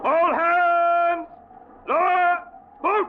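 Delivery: high, loud yell over the wind; shouted orders. Acted. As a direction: roar it to the whole crew. Storm at sea, calling orders.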